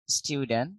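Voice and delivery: স্টুডেন্ট as "styu-den", final t dropped; British pronunciation. Shown as a demonstration. Teacher correcting to the British way, "styu-den". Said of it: "ব্রিটিশরা বলে স্টুডেন্ট".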